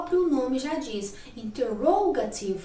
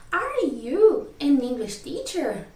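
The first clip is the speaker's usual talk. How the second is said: slow, louder question tune on "Are you an English teacher"; emphatic model question, "Are you an English teacher", drawn out; question intonation; 2 semitones higher, 5 dB louder, much slower than usual. Shown as a demonstration. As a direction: ask "Are you an English teacher" slowly, with big pitch swings. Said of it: "a minha entonação muda".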